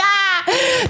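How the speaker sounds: high comic "ah" of dismay; mock dismayed yelp. Traveler caught with water in bag, mock yelp. Retold shock at own mistake.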